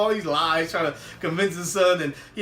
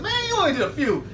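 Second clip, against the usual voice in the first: high, quick mock protest; high-pitched, hurried complaint; 9 semitones higher, faster than usual. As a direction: gripe fast in a raised voice. Acted complaint over the haircut.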